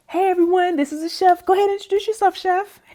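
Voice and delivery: high, loud announcement to a group; calling out an introduction. Retold or imitated. Event lady introducing the chef to the team, loudly calling out. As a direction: present someone to the whole room, high and loud.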